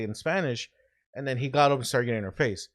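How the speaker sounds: ordinary talk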